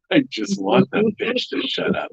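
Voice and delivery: light imitation of an old Southern man, laughing; retelling the old man's line in a playful Southern accent. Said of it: "he said it with a Southern accent".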